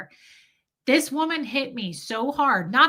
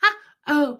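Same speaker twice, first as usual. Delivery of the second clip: imitated gasp; 6 semitones higher, 5 dB louder than usual. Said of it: "She went".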